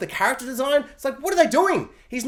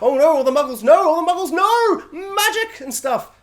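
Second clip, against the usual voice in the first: rising, louder, higher mock alarm; mock panic; 8 semitones higher, 5 dB louder than usual. Mocking the film's build-up, rising high fake panic. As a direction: ham up the doom for the muggles. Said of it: "be like".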